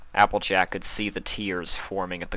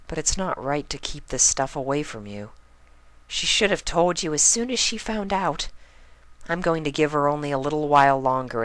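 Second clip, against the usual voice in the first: higher but gentle inner resolve; thoughtful Pinkie; 7 semitones higher than usual. A narrator's reading. Pinkie's thoughts over sleeping Spike.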